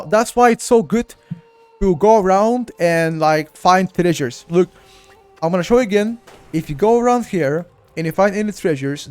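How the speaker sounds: ordinary talk, nothing marked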